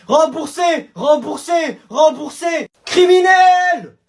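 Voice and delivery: chant, then howl the last word; protest yell. Acted. Cheated pupils accusing the zoo, shouted chant and yell.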